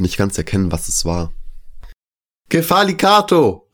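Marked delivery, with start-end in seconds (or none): 2.5-3.7 sudden high shouted warning. A narrator's reading. The Greek owner shouts a warning to his guests, alarmed. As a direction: yell the alarm across the room. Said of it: "rief der Grieche eine Warnung".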